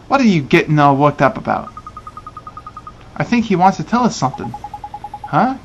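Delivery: ordinary talk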